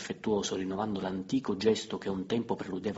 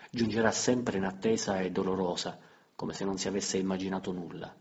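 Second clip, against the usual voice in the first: ordinary delivery, nothing marked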